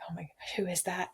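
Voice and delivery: put-on hushed whisper of a scared toddler; whispered mock horror. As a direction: breathe it like a spooked little boy. Fearing son's reaction to bald mom.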